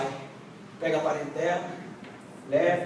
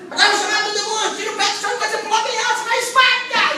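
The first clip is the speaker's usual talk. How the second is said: hammed-up mock, much higher, fast, laughing; mocking imitation; 13 semitones higher, 6 dB louder, faster than usual. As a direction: ridicule the jumping stomper, fast and high. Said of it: "É sacrifício".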